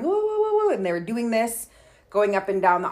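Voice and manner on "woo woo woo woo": high teasing woo chant, mimicking the bus kids; mocking kids' woo. Telling how bus kids mocked her, high jeering woo. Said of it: "And they were going".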